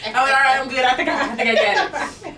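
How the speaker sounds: loud, high laughing banter. Real, not acted. Joking with her friend about side cramps, laughing loudly. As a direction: laugh through the joke, loud.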